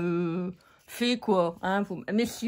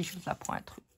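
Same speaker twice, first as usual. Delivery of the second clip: conspiratorial whisper; a secret tip, whispered; 5 semitones lower, 14 dB quieter, faster than usual. Acted. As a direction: let them in on a secret. Mock secret tip to viewers.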